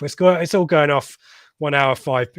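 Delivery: ordinary delivery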